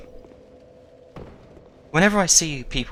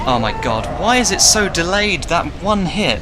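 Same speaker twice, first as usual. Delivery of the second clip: exasperated yell; 6 semitones higher, 5 dB louder than usual. Real, not acted. Frustrated at delayed boss attack.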